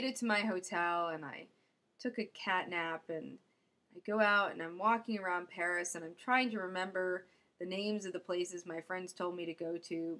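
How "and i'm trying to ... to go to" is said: usual speaking voice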